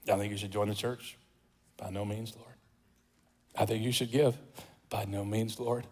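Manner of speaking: very quiet, low mock exchange; muted refusal. Preacher voicing God's prompts and a believer's refusals, very quietly.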